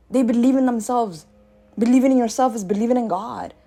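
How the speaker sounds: ordinary talk, nothing marked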